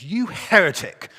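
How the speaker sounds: loud, high and slow, scandalised; outraged shout. Acted. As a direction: bellow the accusation at a heretic. Joke punchline, outraged accusation.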